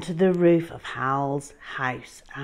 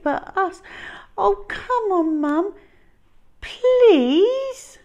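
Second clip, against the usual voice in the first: high, louder begging; pleading boy; 10 dB louder than usual. Acted. Hal pleading with mum to let dinosaur stay, high and begging. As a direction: beg mum sweetly. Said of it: "pleaded Hal".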